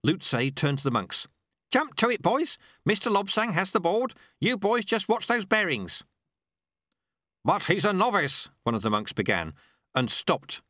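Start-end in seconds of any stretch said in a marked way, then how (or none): 1.7-6.0 high-pitched calling out orders; rally the monks sharply; urgent command. Acted. Sweeper ordering monks to the bearings, urgent command. 7.4-8.5 higher-pitched objection; protesting monk. Acted. Monk objecting to a novice, protesting.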